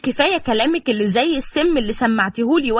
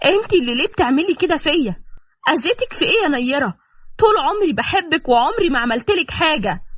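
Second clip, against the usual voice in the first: higher-pitched, wounded questioning; hurt reproach; 7 semitones higher than usual. Acted. Confronting Nayera over how she treats them, hurt reproach. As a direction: protest your innocence, voice raised in pitch.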